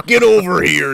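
mock Scorpion shout. Quoting Scorpion catchphrase.

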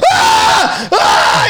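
high shrieking mock scream. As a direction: shriek like you saw something awful. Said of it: "Joel's going".

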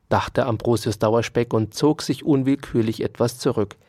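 usual speaking voice

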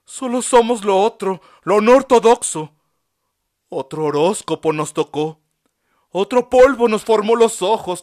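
high, loud, passionate defiant monologue; impassioned speech. A narrator's reading. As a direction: plead your dignity loudly to the doctor. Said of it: "monologó con honroso modo".

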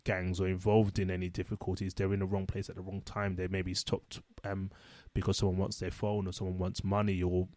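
ordinary delivery

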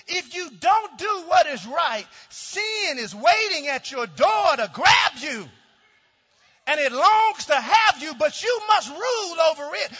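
loud, high, driving sermon voice; preaching at full force. Acted. Pastor preaching to his congregation, full-force shouting. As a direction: preach it hard, rising to a shout.

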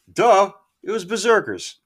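a loud, high "Duh" mocking his own forgetfulness; duh, self-mocking. Real, not acted. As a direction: roll your eyes at yourself out loud. Scolds himself for forgetting.

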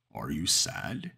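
slow, low, creaturely voice with a rising question; monster voice. A narrator's reading. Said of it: "it asked".

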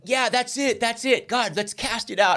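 high-pitched eager realization; retold eager prayer. Student praying to God about the raven, high eager mock prayer. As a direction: seize on the answer, reenacted. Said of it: "I was just, like, praying".

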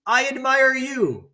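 high, slow, drawn-out holler; echoed call. Acted. Mountain voice answering the son, long high call. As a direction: call it back like a distant echo. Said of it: "The voice answers".

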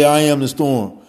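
declared loudly. Shown as a demonstration. Speaker showing how to meet the storm, loud proclamation. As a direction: proclaim it like a battle cry. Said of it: "you gotta say".

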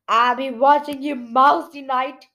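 a hammed-up troll growl. A narrator's reading. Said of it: "he growled".

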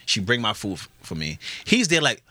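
ordinary talk, nothing marked

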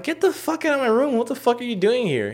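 very high-pitched indignant yelp; retold outrage. Throwing brother out of room, retold outrage. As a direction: order him out, acting out the row.

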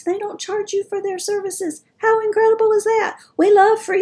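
high, bright excitement; excited. Real, not acted. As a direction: gush about it. Excited about free services.

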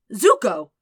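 a sudden cry of worry; alarmed shout. Acted. Sokka calls out in alarm.

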